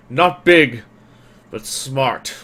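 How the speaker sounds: lightly voiced brute griping about a rat; gruff crewman in broken speech. Crewman griping to the party about a rat, indignant.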